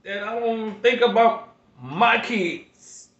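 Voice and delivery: pleading, close to tears, pushing through; emotional. Real, not acted. Grandfather on his channel, overwhelmed thinking of his kids, emotional. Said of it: "I get a little emotional".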